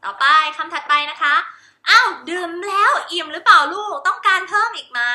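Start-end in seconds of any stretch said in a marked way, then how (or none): 1.9-5.0 put-on bright mother to child. Mother talking to her child.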